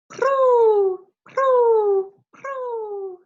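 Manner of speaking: very high, loud cooing; pigeon coo. Retold or imitated. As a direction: call it out like a bird. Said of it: "The pigeons sound like this".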